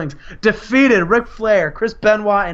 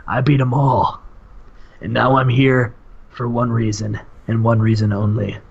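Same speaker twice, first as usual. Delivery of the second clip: low, measured wrestling promo; lowered, self-important champion voice; the Warrior; 5 semitones lower than usual. Acted. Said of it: "he says".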